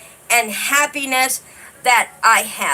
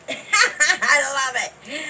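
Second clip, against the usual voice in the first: slow, delighted words through a laugh; real, tickled laughter; 2 semitones higher, much slower than usual. Real, not acted. Delighted laugh at Australian greeting.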